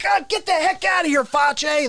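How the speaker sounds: high, exasperated mock yell; hammed-up screamed dismissal. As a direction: yell him away, pitched high. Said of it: "when I scream at him".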